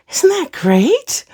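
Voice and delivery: high, slow, proud showing-off; sing-song show-off question; delighted question. Real, not acted. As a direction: ask it beaming with pride. Showing off her tidy jeans.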